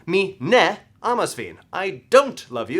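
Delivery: emphatic refusal, heavy weight on ne and don't; stress on NE. Shown as a demonstration. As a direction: hit ne and don't hard to drive it home. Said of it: "I'm going to emphasize the word ne".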